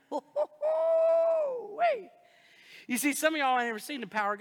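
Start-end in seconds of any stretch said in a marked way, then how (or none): 0.1-2.1 excited outburst. Real, not acted. Sudden laughing outburst.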